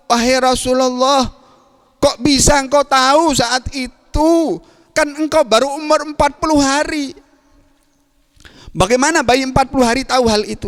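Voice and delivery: high, wondering voice that lingers on the long vowels; amazed uncle. Acted. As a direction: marvel that a forty-day infant could know this. Abbas amazed at the infant Prophet. (paman yang takjub, suara tinggi)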